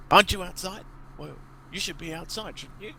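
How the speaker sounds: high questioning goblin voice, trailing off; suspicious goblin. Acted. Goblin boss questioning the disguised player, high and suspicious. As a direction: probe him like a wary boss. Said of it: "he says".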